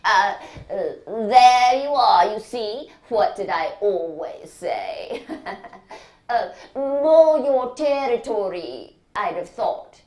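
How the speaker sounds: lightly voiced gloating consultant; smug surgeon. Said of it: "he said".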